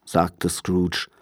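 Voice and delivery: ordinary delivery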